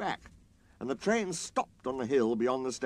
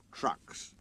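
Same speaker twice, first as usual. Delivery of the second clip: firm repeat; 5 dB quieter than usual. A narrator's reading. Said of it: "his driver repeated".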